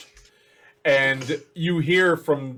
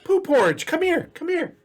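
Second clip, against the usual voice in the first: much higher, squeaky call; goblin calling; 14 semitones higher than usual. Acted. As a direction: call the bunny over in a high goblin voice. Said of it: "you hear Chuckle Nuts go".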